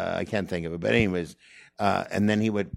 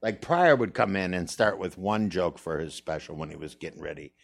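normal talking voice

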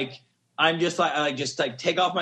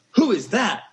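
excited; 4 semitones higher, 5 dB louder than usual. Retold or imitated. Spotting the hot host.

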